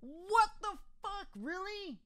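high, drawn-out what; can't believe it; stunned disbelief. Real, not acted. Gamer killed suddenly, high stunned disbelief.

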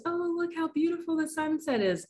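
acted swooning in a high voice; sentimental gushing. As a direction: coo it high, as if moved by beauty. Mock gushing over a sunset.

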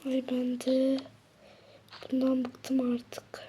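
slow weary whisper; whispered complaint. Acted. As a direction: sigh it out in a whisper. Fısıltıyla şikayet. (bıkkın fısıltı)

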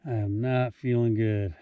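quiet, drained and nauseous; soft, sick and worn out; altitude sick. Real, not acted. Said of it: "Maybe altitude sickness".

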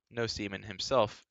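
ordinary delivery

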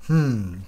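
loud, embarrassed "hm"; sheepish grunt of a hum. A narrator's reading. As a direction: hum it awkwardly, caught out. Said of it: "brummte er verlegen".

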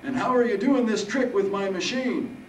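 put-on high, loud old-man voice; amused old Harold. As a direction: question him boldly as high-voiced Harold.

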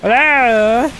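ordinary talk

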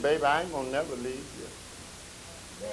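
soft, slow mock sweet-talking boyfriend; boyfriend's promise. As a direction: croon it like a smooth liar. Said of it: "young ladies who said".

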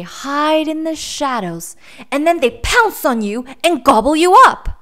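put-on high child voice building to loud; dramatic little boy. A narrator's reading. Boy warns the bear about pouncing beasts, dramatic. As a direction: build the scary warning to a loud peak.

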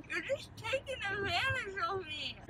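crying. Real, not acted. Upset, crying.

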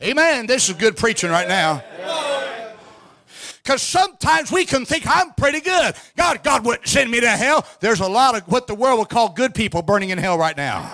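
high, forceful revival sermon delivery; fervent preaching. Real, not acted. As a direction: drive it home to the congregation, drawing out key words.

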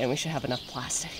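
normal talking voice

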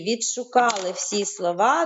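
ordinary talk, nothing marked

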